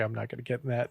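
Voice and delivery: low, soft retreat; muted backing down. Retold or imitated. Chef backs off a story in front of publicist, low and soft. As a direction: drop it almost under your breath.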